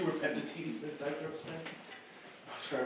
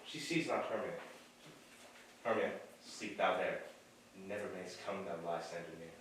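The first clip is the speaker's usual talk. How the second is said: low, hushed, secretive; quiet aside; 9 semitones lower, 8 dB quieter than usual. Acted. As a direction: murmur it as he sneaks off. Lysander quietly leaving her.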